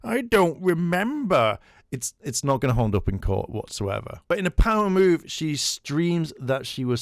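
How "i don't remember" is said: higher-pitched, drawn-out mocking imitation; put-on "poor me" excuse, slow and high; mock feigned forgetfulness. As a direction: play dumb in a high, exaggerated mock voice. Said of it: "stupid thing of going like".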